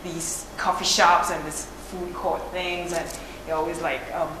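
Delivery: ordinary talk, nothing marked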